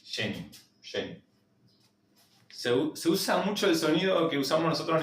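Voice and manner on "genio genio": genio with a soft sh and a final U; Portuguese gênio. Shown as a demonstration. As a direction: say genio as shenyu. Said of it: "Va a salir bastante fea esta".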